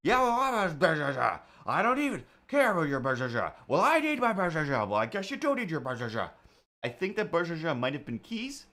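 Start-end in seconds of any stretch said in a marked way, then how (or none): 0.0-6.3 high, garbled couple's screaming match; mock yelling. Neighbours fighting over keys heard through the wall, mock yelling. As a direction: imitate neighbours shrieking muffled nonsense at each other. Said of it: "one of them is yelling".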